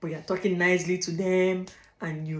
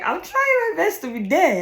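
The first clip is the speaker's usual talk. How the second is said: pleading protest; 10 semitones higher, 7 dB louder than usual. Retold or imitated. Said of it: "Even if you're like".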